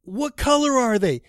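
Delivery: slow, high, loud demand; retold screaming. Driver trying to spot the fighter, screaming slowly. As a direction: yell the question slowly, acting out exasperation. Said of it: "I'm screaming at him".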